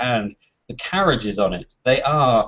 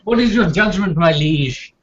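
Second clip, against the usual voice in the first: courtier voice; 7 semitones higher than usual. Acted. Courtier asks king.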